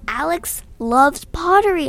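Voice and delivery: high, loud holler. A narrator's reading. Narrator hollering the plan to Nick, high holler. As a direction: holler it across the rooftop. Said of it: "I hollered".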